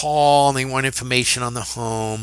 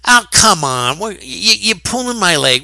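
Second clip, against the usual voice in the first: incredulous disbelief; 6 semitones higher, 4 dB louder than usual. Retold or imitated. Disbelief at partner's news.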